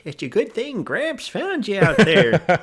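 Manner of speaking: high, loud, slow hammed-up old-timer. Rescuer's family talks to victim, hammed-up old-timer voice. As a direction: drawl it like a folksy old gramps.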